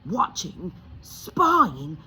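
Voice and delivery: rising, rambling panic; fretting out loud; wild muddle. Acted. Uncle Vernon to his wife, muddled and wild. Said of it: "muddled Uncle Vernon wildly".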